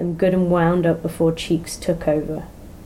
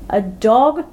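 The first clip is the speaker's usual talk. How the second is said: incredulous; 6 semitones higher, 4 dB louder than usual. Acted. David incredulous they stopped.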